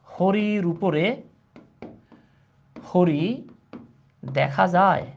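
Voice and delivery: slow, with long pauses between phrases; word-by-word dictation. Shown as a demonstration. Teacher dictating a Bangla riddle to class, in slow separated pieces. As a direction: dictate it piece by piece, leaving big gaps.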